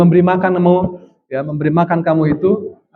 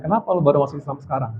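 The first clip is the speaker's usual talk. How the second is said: quick quiet question; 4 semitones lower, 7 dB quieter, much faster than usual. Retold or imitated. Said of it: "ketika saya tanya".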